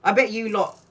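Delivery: usual speaking voice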